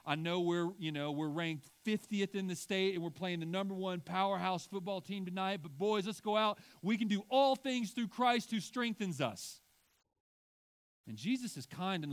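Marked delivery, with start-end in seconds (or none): 0.0-9.6 put-on loud, high rallying coach firing up the boys; locker room pep talk. Coach to his outmatched football team, loud rallying cry. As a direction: pump up the team before the big game. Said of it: "Like the sports team that's like".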